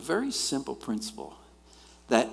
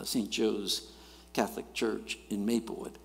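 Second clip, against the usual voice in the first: ordinary delivery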